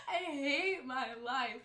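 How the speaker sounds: slow, half-crying through laughter; laughing despair. Real, not acted. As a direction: wail it while still cracking up. Still laughing at herself.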